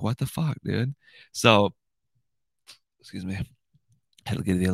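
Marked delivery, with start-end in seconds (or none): 0.0-0.9 a touch higher, disbelieving; exasperated, baffled; mock outrage at the wrong Google hours; incredulous. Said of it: "We're like".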